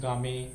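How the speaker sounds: गामे in two syllables, GAA-may; बच्चों का गलत उच्चारण. Retold or imitated. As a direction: sound the final e in गामे, imitating misreading children. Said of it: "कई बच्चे लोग इसको क्या पढ़ देते हैं". (गामे, आख़िरी ई बोलकर)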